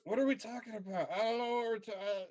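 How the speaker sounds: put-on high, fast, confused drunk voice; befuddled town drunk. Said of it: "Ricky goes".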